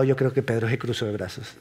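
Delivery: ordinary delivery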